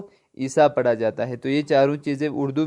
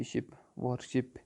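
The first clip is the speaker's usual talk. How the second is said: Worship said slowly and much softer, almost to herself; teacher softly modelling English worship; soft English word; 2 semitones lower, 14 dB quieter, much slower than usual. Shown as a demonstration. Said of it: "English میں جس کو کہتے ہیں worship".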